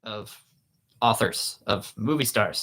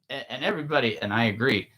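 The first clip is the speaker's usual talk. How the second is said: usual speaking voice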